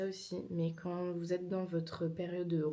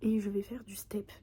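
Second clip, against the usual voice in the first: whispering. Real, not acted. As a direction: whisper to the camera.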